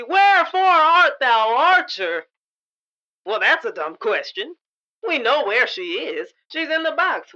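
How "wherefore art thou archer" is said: theatrical lament. Acted. Shakespearean lament.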